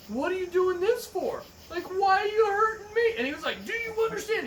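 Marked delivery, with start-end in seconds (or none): 0.1-3.1 pleading victim. Retold or imitated. 3.6-4.4 much higher, demanding sergeant; stern drill sergeant. Retold or imitated. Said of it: "He kept repeating".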